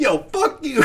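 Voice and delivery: high-pitched pretend yell through laughter; jokey, high call-out; mock yelling. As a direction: laugh while play-yelling across a bar. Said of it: "Just yelling".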